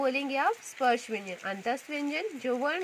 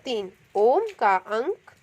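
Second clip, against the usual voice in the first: तीन and ओम said slowly and louder; slow, stressed; 6 dB louder, slower than usual. Shown as a demonstration. Teacher to pupils, stressing the pluta sign slowly. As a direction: linger on each word. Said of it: "प्लुत कहलाएगा".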